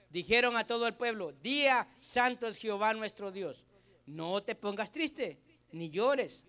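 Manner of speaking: loud, measured Bible reading from the pulpit; scripture reading. Acted. Reads Nehemiah aloud to congregation.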